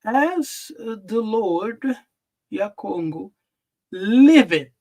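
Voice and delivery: As the Lord drawn out slowly, liveth punched loud; slow emphatic reading. Shown as a demonstration. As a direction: hold the phrase, then hit liveth hard. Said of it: "That's what we see here".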